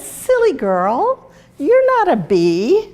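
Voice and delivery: mocking bee. Bee mocking stung Flutterby, slow loud teasing. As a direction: scold her like a smug bee.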